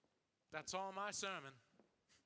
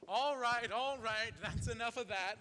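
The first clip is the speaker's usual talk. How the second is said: raised voice; 5 semitones higher, 8 dB louder than usual. Acted. Stage manager quiets the crowd.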